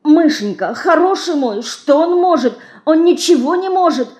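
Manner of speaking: put-on a touch of fear in the old man's voice; frightened mumbling. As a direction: mumble in a fright to calm her. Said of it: "испуганно забормотал Малайка".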